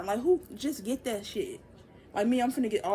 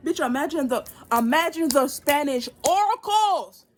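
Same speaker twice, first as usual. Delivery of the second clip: gleefully hyped, voice raised and slowed; excited exclaiming; 5 semitones higher, 7 dB louder than usual. Real, not acted. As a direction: crow it loudly, stretching the last word. Excited boast about future Spanish readings.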